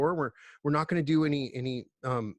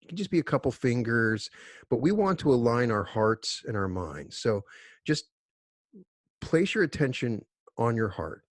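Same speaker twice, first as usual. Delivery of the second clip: guided meditation. Acted. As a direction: guide them unhurriedly into stillness, letting phrases settle. Leads a group meditation.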